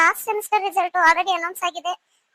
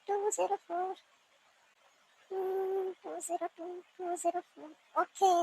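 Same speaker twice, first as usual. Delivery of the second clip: very soft, absorbed murmur; muttering to oneself; 11 dB quieter than usual. Acted. Student scanning the results for a number, muttering. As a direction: read the digits under your breath while searching.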